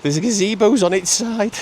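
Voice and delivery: imitating his wife's alarmed cry; panicked voice. Wife shouting to him about the toppled gazebo, hammed-up panic. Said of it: "this panicked voice going".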